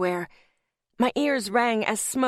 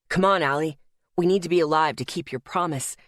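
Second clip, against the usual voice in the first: lower, insistent voice; urging male character; 5 semitones lower than usual. A narrator's reading. Asher pulling Allie to her feet, low urging. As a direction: deepen the voice and press firmly.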